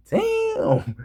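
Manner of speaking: cousin's shocked damn. Retold or imitated. Cousin seeing the smashed finger, high shocked damn. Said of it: "He like".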